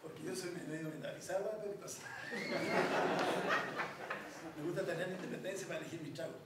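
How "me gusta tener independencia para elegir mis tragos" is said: ordinary delivery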